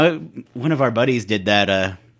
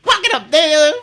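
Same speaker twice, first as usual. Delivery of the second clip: much higher, louder, slow hammed-up stand-up comic shout, laughing; 14 semitones higher, 5 dB louder than usual. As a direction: ham up a Def Comedy Jam stage bit. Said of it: "very stereotypical way of speaking".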